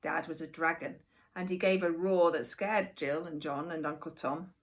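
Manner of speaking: ordinary delivery, nothing marked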